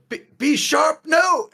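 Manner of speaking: Mandy crying out as she is struck; panicked scream. Acted. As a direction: scream as the blow lands. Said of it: "as she sort of screams".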